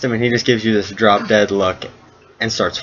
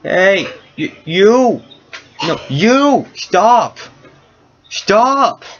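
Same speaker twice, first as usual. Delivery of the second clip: put-on high-pitched cranky old-man holler; irritated old geezer yelling for attention; aggravated old man; 10 semitones higher, 3 dB louder, much slower than usual. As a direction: call out sharply in a high old man's voice. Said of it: "a very aggravated old man go".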